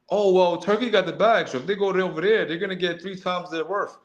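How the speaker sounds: sneering parody of fan talk; mocking fans. Mocking fans praising Turkey's money.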